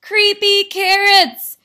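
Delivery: high, slow, loud alarmed shout. Acted. Jasper spots carrots on his wall, shouting. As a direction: stretch it out in a loud cry. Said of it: "he shouted".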